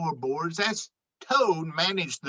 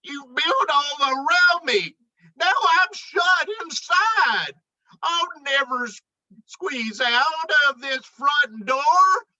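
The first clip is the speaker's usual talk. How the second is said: trapped and whining; 7 semitones higher than usual. Acted. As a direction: complain from inside the box. Moose trapped inside, complaining.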